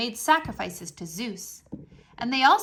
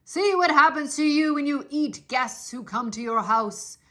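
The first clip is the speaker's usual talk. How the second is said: jeering; 3 dB louder than usual. A narrator's reading. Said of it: "heard his jeers".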